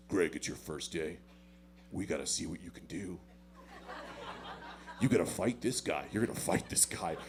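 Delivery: deep instructor voice. Retold or imitated. Instructor quoted.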